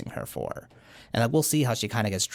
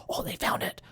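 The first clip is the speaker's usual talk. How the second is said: whispered; 6 dB quieter than usual. Retold or imitated. Guy reacts after gasp.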